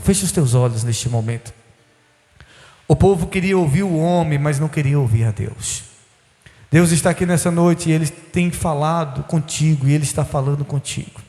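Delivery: low, intense preaching prayer; altar call prayer. Acted. Pastor calling the congregation to surrender, intense prayer. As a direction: pray over them, pressing and earnest.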